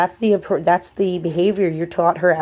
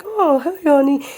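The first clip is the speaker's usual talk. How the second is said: put-on much higher excited baby talk; gushing dog greeting; 8 semitones higher than usual. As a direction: coo over the dog the wrong way. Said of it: "I've been like".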